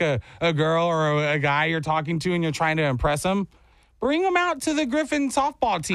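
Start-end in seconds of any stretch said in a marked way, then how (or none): 4.0-5.9 high, playful promo call; mock sales pitch. Host jokingly invites rival teams, mock promo pitch. Mock advertisement for losing team.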